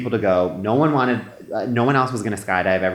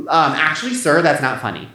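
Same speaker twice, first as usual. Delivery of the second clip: higher, louder prim scolding; a stickler telling off the instructor, pitched up; prim reproach; 6 semitones higher, 4 dB louder than usual. Retold or imitated. Woman scolding instructor in plane.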